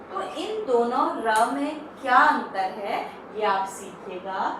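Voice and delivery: ordinary talk, nothing marked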